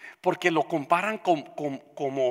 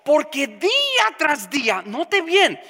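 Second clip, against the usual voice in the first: preaching at full force; 7 semitones higher, 6 dB louder than usual. Acted. Pastor exhorting the congregation, preaching at full force. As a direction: pound out each phrase like a revival preacher.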